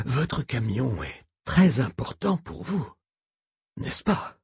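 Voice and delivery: put-on wheezing old man, slow; breathless old person. A narrator's reading. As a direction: push words out between wheezes. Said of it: "entre deux expirations sifflantes".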